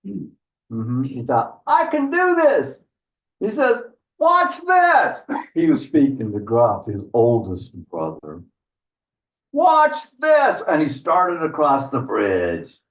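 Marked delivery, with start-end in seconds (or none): 1.7-5.2 high-pitched, eager little goat boast; cocky middle goat. Acted. Confident middle goat boasting in story. 9.5-10.6 high-pitched, eager little goat boast; cocky middle goat. Acted. Middle goat showing off to brother.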